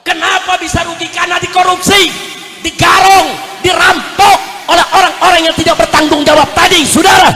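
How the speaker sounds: very loud, high accusing shout; blazing sermon denunciation; full-force preaching. Real, not acted. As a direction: thunder it out to the crowd.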